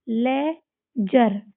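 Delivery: Laser stretched out slowly, no d sound; slow teacher model reading; slow demo word. Shown as a demonstration. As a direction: drag the word out so pupils hear each part. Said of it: "इसमें भी डी को silent कर देंगे".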